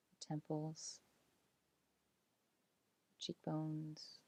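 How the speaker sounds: calm meditation voice with long pauses; unhurried, soothing body-scan narration, letting phrases settle; guided relaxation. Acted. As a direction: guide them gently into release, phrase by phrase.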